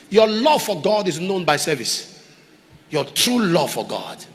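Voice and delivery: normal talking voice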